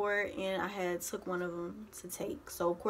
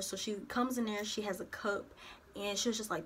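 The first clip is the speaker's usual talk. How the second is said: ordinary talk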